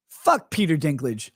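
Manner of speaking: usual speaking voice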